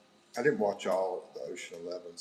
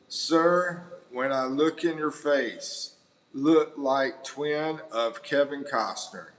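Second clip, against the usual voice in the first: slow, loud, lightly put-on Costner voice; a hint of Kevin Costner, slow and loud; Kevin Costner accent; 4 semitones higher, 6 dB louder, slower than usual. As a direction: drag it out in a mild movie-star drawl. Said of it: "I'm gonna try to do his, uh, accent, Kevin Costner".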